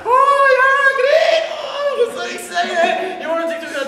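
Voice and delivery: very high, loud Irish yelps of delight; overjoyed Irish brother shrieking; sung-out Irish whoops, then babbling; squealing Irish glee. Retold or imitated. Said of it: "funnier if he does".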